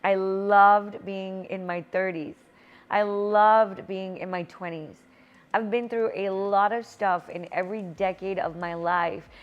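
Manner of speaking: ordinary delivery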